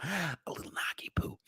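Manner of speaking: soft unvoiced whisper. Real, not acted. Hinting at trouble to chat, soft whisper. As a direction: hush it like a secret.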